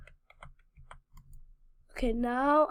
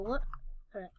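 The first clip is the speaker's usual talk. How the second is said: ordinary delivery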